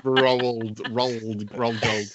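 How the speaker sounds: rolled said with a clear L, rolled gold; careful Australian rolled gold; clear pronunciation. Shown as a demonstration. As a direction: stress the L in rolled, then rolled gold. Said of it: "No, uh, rolled".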